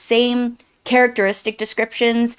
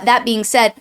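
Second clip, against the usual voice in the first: usual speaking voice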